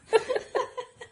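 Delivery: a high, real peal of laughter. Real, not acted. Reacting to the Lola coffee-name story, high laugh. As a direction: laugh freely at the punchline.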